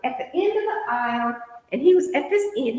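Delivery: normal talking voice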